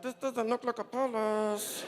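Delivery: slow, strained worried voice; nervous aside. Retold or imitated. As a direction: drag it out uneasily. Muttered worry at the gate.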